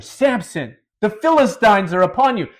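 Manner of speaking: loud, high warning shout; acted-out cry of alarm; yelled alarm. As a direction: yell it right into the sleeper's ear. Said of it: "she yells in his ear".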